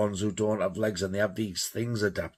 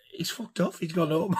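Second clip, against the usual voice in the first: amused disbelief; 7 semitones higher than usual. Retold or imitated. Acting out amused disbelief to friends.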